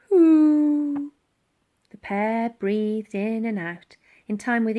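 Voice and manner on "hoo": higher, crying little hoot; a drowsy animal reply; sleepy mammoth hoot. A narrator's reading.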